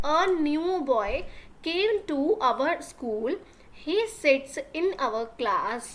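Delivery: drawn out, word by word; slow dictation of the girl's answer; very slow read-aloud. Shown as a demonstration. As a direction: dictate each word slowly to the class. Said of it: "teacher reads the girl's line".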